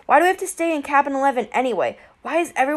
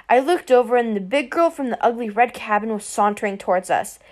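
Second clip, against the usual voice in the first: plain reading voice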